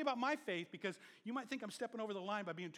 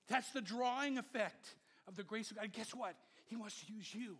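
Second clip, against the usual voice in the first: ordinary talk, nothing marked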